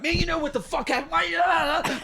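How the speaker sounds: high-pitched angry outburst, acted out; hammed-up furious yell, voice shooting up; retold yelling. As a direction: blow up in a reenacted yell. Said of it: "then I start yelling".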